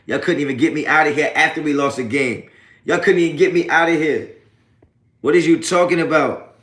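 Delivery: fired-up shout at trolls who couldn't run him off; yelling taunt. Real, not acted. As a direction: yell it at the chat, defiant. Yelling at trolls after the loss.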